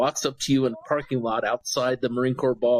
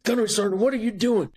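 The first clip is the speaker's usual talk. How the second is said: mock incredulous sergeant major; 6 semitones higher than usual. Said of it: "He's like".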